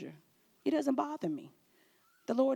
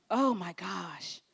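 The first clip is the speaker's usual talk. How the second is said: slow exasperation; 5 semitones lower, 5 dB quieter, much slower than usual. Real, not acted.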